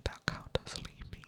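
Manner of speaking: ordinary talk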